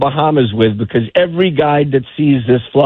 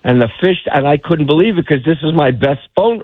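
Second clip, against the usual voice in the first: plain reading voice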